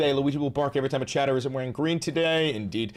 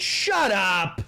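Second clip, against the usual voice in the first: mock shut up; 7 semitones higher, 4 dB louder, much slower than usual. Mock telling chat off.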